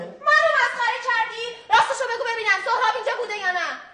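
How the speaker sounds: high, loud furious demand; wife shouting at the cousin who hid her husband, furious; angry shout. Acted. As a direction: yell at him for mocking you. Wife accuses him of mocking her.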